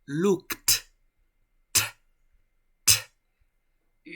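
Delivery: "Looked" as lookt, with a puff of air on the T; aspirated T. Shown as a demonstration. Teacher demonstrating airflow, "Looked" and T with released air. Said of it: "bota aire, mira".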